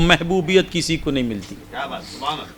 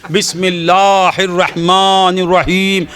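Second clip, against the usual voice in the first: slow, drawn-out tajweed recitation; careful recitation; 2 semitones higher, slower than usual. Retold or imitated. Woman reciting over the soil, slow and careful. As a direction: recite each letter carefully and slowly. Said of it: "تجوید کے ساتھ، تلفظ کی برابر ادائیگی".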